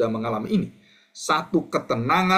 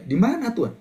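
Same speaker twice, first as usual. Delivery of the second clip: doubting believer; 9 semitones higher than usual. Acted. Believer questioning God in story.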